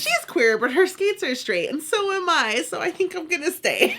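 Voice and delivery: laughing while voicing a cheerful fan; mock fan voice laughing. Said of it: "They're like".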